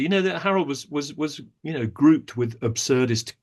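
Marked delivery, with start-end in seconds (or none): none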